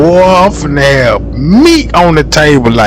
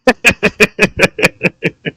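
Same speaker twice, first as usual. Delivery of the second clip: short laugh bursts; 4 dB quieter than usual. Real, not acted. Reacting to a funny video.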